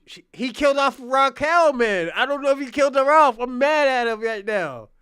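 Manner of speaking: loud, fast, heated complaint about fifty; venting loud, fed up and hurt; real anger. Real, not acted. Venting to Kwame about the killed-off character, loud and angry. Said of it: "I'm so mad at fifty".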